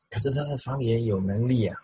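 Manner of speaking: ordinary talk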